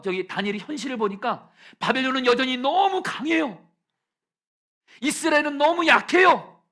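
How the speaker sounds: loud, rising preacher's cry; preaching shout. Real, not acted. Pastor preaching to the church, slowing into a shout. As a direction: cry it out to the congregation.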